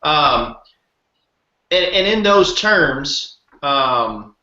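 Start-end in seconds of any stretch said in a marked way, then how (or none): none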